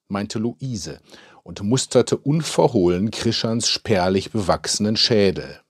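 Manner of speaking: ordinary delivery, nothing marked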